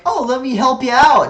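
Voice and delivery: loud, high, over-eager helper; mock-helpful. Streamer mocking the game's camera for butting in, mock-helpful. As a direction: chirp it loudly, mocking false helpfulness.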